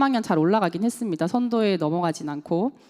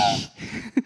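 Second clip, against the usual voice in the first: laugh; 5 dB quieter than usual. Real, not acted. After the mock rant.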